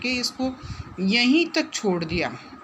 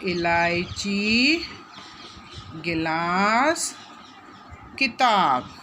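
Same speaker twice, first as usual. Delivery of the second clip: इलायची, गिलास, किताब each drawn out slowly, with pauses between; slow model reading; much slower than usual. Shown as a demonstration. Teacher modelling words for pupils to read, slow and stretched. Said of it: "तो पढ़िए इनको".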